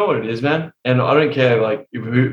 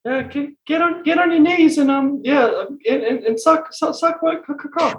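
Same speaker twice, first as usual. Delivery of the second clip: timid squeaky seducer; 11 semitones higher than usual.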